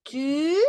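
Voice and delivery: TU held long, pitch rising; long sound. Shown as a demonstration. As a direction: draw out the vowel in "Tu". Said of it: "que tenían un sonido largo". ("Tu" con sonido largo)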